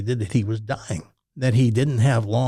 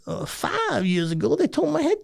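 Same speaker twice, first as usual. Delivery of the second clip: put-on high, amused old man; imitating Reggie; 11 semitones higher than usual. Reggie waves off his death sentence to the host, high and amused. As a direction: shrug off the doctors with a grin.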